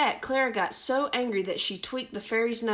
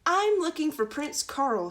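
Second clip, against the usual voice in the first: Clara's voice; 7 semitones higher, 3 dB louder than usual. Acted. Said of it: "said Clara".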